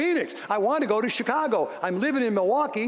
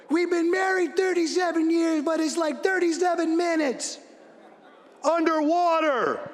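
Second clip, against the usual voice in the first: imitating Lisa; 8 semitones higher than usual. Quoting his wife Lisa's joke to the crowd, high mock shout. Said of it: "like Lisa likes to say".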